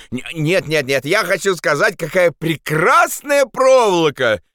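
stammered start rising to a high shout. Acted. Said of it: "крикнул Андрес". (крик с поспешной похвалой)

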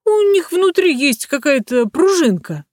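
high-pitched mimicking voice; high mock doll voice; talking wax figures. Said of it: "Восковые люди на ярмарках ходят и говорят".

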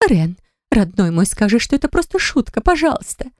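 high-pitched, distressed plea; pleading. A narrator's reading. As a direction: beg your brother, voice climbing high. Sister begs brother to deny it. (умоляюще)

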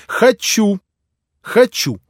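high, loud capricious insistence; flaring up. Acted. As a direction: insist with sudden fire. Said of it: "с загоревшимися вдруг глазами".

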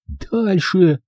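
lightly voiced girl, high and breathy-ended exhale; breathed out. A narrator's reading. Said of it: "выдохнула она".

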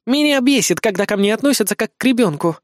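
high-voiced girlish annoyance; irritated sulk. A narrator's reading. Girl sick of being treated as a child, irritated. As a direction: complain in a sulky pout. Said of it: "раздражённо произнесла она и тут же нахухлилась".

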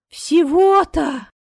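high, loud, drawn-out relief; stretched exclamation of relief; relieved. A narrator's reading. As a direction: let it out as if a weight dropped. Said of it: "облегчённо выдохнула девушка".

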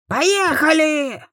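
high squealing drawn-out order; shrill command. A narrator's reading. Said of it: "не обошлось без визга".

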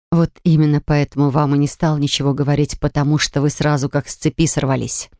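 plain reading voice

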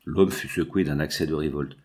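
plain reading voice

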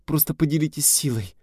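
high, eager plea; girl grabbing his sleeve, ardent; heated urging. A narrator's reading. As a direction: press him urgently. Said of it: "горячо заговорила девушка".

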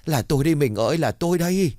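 high, stammering cry of pain. Acted. Husband under the carrying pole begs his wife, high pained cries. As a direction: whimper it high as the blows land. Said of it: "mà đánh chồng".

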